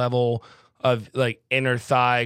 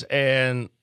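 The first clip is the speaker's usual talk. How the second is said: normal talking voice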